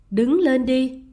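loud, detached order from a dowager; imperious and unmoved; cold command. A narrator's reading. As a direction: dismiss the kneeling man with cool authority. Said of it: "Bà lãnh đạm nhìn".

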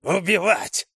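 high, frenzied attack cry; growled war cry. A narrator's reading. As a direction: snarl it out, lunging to kill. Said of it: "зарычала она". (яростно прорычать)

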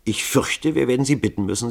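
plain reading voice

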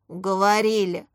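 a touch of grumpy giving in; grumbling. A narrator's reading. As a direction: grumble it reluctantly. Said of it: "пробурчал Старостин".

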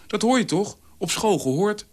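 plain reading voice

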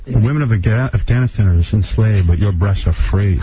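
low lover's croon; seductive voice. Acted. [name] playing seductive lover.

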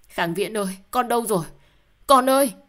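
a mother screaming for her lost son; panicked scream. A narrator's reading. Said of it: "vang lên một tiếng hét thất thanh". (tiếng hét thất thanh gọi con)

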